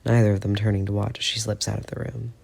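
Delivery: usual speaking voice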